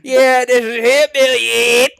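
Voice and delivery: slow, loud, high hillbilly; hammed-up hillbilly drawl; hillbilly accent. A narrator's reading. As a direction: stretch it out in a hillbilly holler. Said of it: "with his hillbilly accent".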